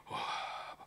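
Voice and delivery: slow, soft drowsy prayer voice; sleepy mumbled prayer. Retold or imitated. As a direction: mumble the prayer half asleep. Meniru doa saat mengantuk. (doa lirih setengah tertidur)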